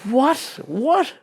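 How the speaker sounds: high, rising disbelief; mock astonishment, swooping up high; incredulous. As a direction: ask it twice as if stunned. Retold disbelief at deals coming.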